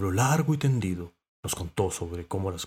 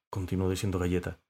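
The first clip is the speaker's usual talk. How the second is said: plain reading voice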